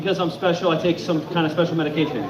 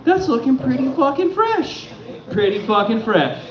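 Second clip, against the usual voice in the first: high, smug voice admiring himself; mock admiring; 6 semitones higher than usual. A man admiring his trimmed eyebrow in the mirror, high and smug. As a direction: preen like a fool at the mirror.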